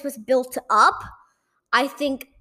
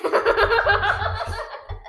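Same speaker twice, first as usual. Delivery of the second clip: laugh; 8 semitones higher than usual. Real, not acted. Laughing at Spanish joke.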